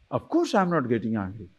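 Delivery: soft, composed denial of anger; acted calm denial. Person answering a doubter about anger, soft and composed. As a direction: softly insist on being unbothered.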